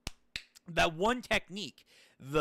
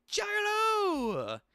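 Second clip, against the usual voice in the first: high, drawn-out cry of a name; hammed-up shout after a falling friend; calling out in alarm. Acts out crying out to falling friend.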